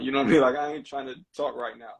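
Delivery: ordinary talk, nothing marked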